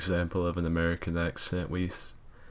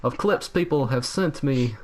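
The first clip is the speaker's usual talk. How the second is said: high, loud, bright youtuber intro voice; over-the-top channel intro voice; PVP Skills impression; 7 semitones higher, 4 dB louder than usual. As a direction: ham up a cheery youtuber greeting. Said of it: "I am P V P Skills".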